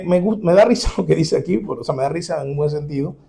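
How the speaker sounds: ordinary delivery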